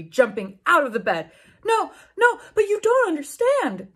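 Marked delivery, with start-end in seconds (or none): 1.6-3.9 much higher, louder whiny plea; pleading. A narrator's reading. Draco pleading with the heroine, high whiny plea. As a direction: beg like a panicked boyfriend. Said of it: "Draco pleaded".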